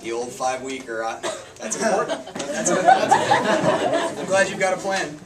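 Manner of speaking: chuckling through fake praise; laughing mock approval. Laughing, teasing a friend about his McDonald's date plan. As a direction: tease him while laughing, hammed up. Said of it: "And you're like".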